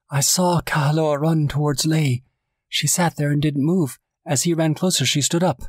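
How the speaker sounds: high, urgent eyewitness account; high-pitched distraught telling; acted distress. A narrator's reading. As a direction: keep the pitch well up as the horror is retold. Hione continues her hysterical eyewitness account.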